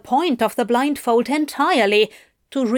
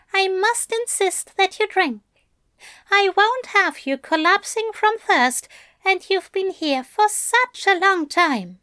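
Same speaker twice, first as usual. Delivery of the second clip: pitched-up gentle angel praising his demon; Aziraphale's voice; 10 semitones higher than usual. A narrator's reading. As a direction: purr the praise up high like a doting angel.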